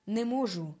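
plain reading voice

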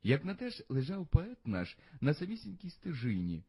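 plain reading voice

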